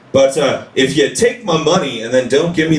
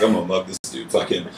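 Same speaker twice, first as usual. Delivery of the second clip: menacing mutter; 10 semitones lower, 9 dB quieter than usual. Retold or imitated. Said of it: "He's like".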